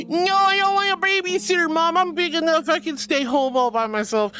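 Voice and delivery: put-on whiny kid. Kid telling mom they don't need a babysitter, whiny. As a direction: pout it out like a kid refusing a babysitter. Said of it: "Usually the babies are like".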